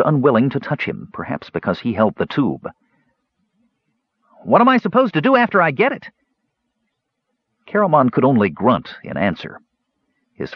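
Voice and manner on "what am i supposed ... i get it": fast, high-pitched panic; high, rushed, exasperated question; frantic. A narrator's reading. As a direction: demand an answer in a fluster. Frantic kender struggling with tube.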